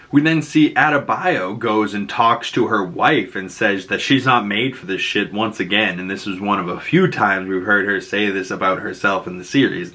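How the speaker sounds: ordinary talk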